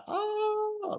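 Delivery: a high, shocked "Oh" squeaked out as the bike explodes; shocked gasp. Retold or imitated. Watching a biker crash into the cliff, a high shocked gasp. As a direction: let a sudden squeak of shock escape. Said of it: "like this sort of thing".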